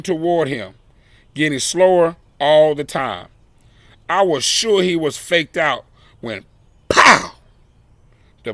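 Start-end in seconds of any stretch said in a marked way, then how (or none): none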